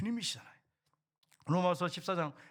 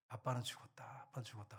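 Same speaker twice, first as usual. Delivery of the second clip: quiet declaration; 6 semitones lower, 11 dB quieter than usual. Retold or imitated. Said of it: "우리 아들한테 제가 그랬어요".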